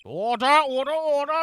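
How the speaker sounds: loud stern judge; judge calling order. Acted. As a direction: bang the gavel voice.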